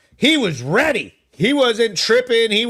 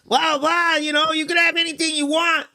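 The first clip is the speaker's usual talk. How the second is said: high, eager mock voice of the pizza guy; hammed-up generous pizza owner; imitating pizza owner; 9 semitones higher than usual. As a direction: pitch way up like an overeager host. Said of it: "He goes".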